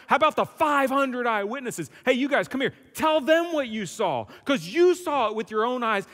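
loud, high, hammed-up Paul waving people up front; Paul calling witnesses. Paul daring doubters to ask the eyewitnesses, hammed-up loud calling out. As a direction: call out like summoning witnesses from the crowd. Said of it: "So Paul would probably say".

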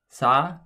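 Sa-ram with a rising end; Korean word. Shown as a demonstration. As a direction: say Sa-ram syllable by syllable. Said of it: "The third word I would like you to try is".

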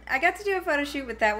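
ordinary talk

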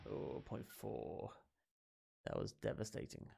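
soft whisper of defeat; deflated whisper. Real, not acted. Gamer seeing a low score, whispering in defeat. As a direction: breathe it out crushed.

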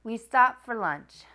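ordinary delivery

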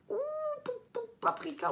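"pou, pou, paprika" très haut; effarée. Real, not acted. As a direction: souffle d'étonnement devant tant d'épices. Effarée devant la liste d'épices. (étonnement amusé)

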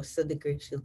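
ordinary delivery

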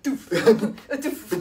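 loud goofy doof doof; silly swim noises. Retold or imitated. Friend miming a clumsy swim, loud silly grunts. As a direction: bark out the swim sounds in fun. Mimicking swimming against logs.

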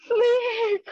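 high, loud cry of terror; terrified cry. Acted. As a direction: shriek the word in panic. Lizard sees the snake.

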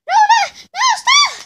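very high, loud panicked no; frantic shout. Pretend. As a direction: yell as if being dragged away. Boy kicked out, protests.

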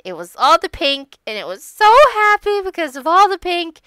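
high, loud burst of storybook delight; gleeful storytelling. Acted. Telling the camel's happy story to friends, gleeful. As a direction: gush it, rising high and loud.

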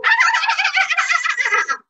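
high, loud, rapid cackling; witch cackle. Retold or imitated. Witches ride through the house, high loud cackle. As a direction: cackle wildly like a flying witch. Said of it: "the witches would go".

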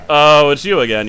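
ordinary delivery

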